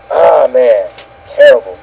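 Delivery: ordinary talk, nothing marked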